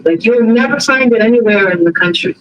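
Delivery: normal talking voice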